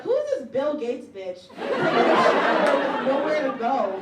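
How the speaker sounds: comic congratulating herself for showering, loud; hammed-up self-praise. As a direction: cheer yourself on absurdly.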